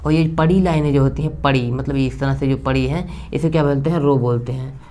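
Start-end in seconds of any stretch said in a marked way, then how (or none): none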